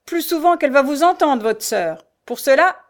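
loud, high jeering retort; mock the pleading victim cruelly; cynical sneer. A narrator's reading. Beggar interrupts the blind girl's pleas, cynically sneering. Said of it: "Un ricanement cynique l'interrompit".

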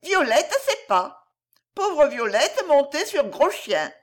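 put-on high, small childlike voice, comforting; a toddler soothing a crying friend; little girl voice. Little Violette comforting the weeping bear boy, high childlike voice.

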